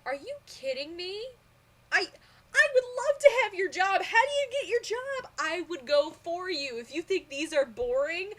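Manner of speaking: mock-outraged fangirl rant; incredulous excited outburst. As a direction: gush at someone who calls it boring. Retold reply to bored reviewer.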